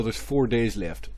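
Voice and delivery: normal talking voice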